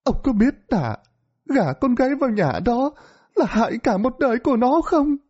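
high, reproachful ghostly wife voice; a dead wife scolding her husband. A narrator's reading. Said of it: "Nghe bà vợ trách móc".

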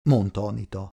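ordinary delivery

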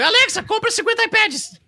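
a cheeky parrot ordering iPads through the Alexa. Retold or imitated. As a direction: squawk the order like a greedy parrot. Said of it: "se fosse assim".

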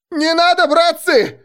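very high, loud panicked cry; frantic, high-pitched pleading shout; wild yell. A narrator's reading. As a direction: scream for mercy in feigned panic. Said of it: "дурно заорал я".

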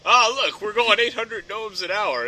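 a touch of a chirpy motorist; proud driver. Pretend. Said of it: "speedometer in gnomes".

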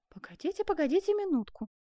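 put-on flustered young woman. Seamstress holds off her lover's embrace, flustered. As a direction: fend off an eager suitor lightly.

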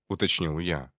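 usual speaking voice

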